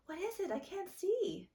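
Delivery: put-on high whiny little-girl voice; whining child. A narrator's reading. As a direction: whine it like a small child. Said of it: "Akir whined".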